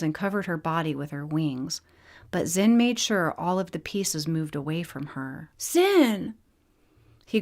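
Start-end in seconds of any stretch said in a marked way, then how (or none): 5.6-6.3 higher, louder joyful call of his name. Acted. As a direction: cry out his name as she sees him. Zara calls out to Zin.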